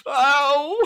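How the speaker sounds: loud, high comic "ow"; acted pained yelp. Recounting a deadlift scraping his leg, pained yelp. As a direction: yelp as if scraped on a raw wound. Acts out scraping scar on bar.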